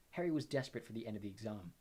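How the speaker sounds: ordinary delivery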